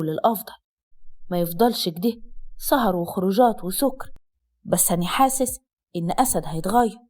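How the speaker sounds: low-pitched voice of a man explaining; earnest brother-in-law. Acted. (أخو الزوج بيشرح بصوت واطي)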